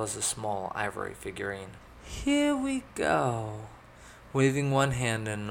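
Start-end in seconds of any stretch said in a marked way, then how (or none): 2.0-3.7 high, very slow, falling voice; drawn-out brace before trying; nervous noblewoman. A narrator's reading. Elizabeth about to attempt her spell, high hesitant voice.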